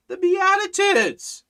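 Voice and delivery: high, loud, drawn-out answer; emphatic exclamation. Real, not acted. Preacher answers his own question about which commandments, exclaiming it. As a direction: call out the obvious answer, slow and high.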